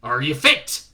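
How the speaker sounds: put-on slow loud mixed British regional accent; a drill sergeant's regional accent; Shadwell's wandering accent. Shadwell interviewing Newton in a regional accent. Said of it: "accent was unplaceable".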